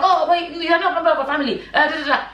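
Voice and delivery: mimicking critics. Vlogger imitating viewers who fault her passion, mocking. Voicing critics' objection, then dismissing it.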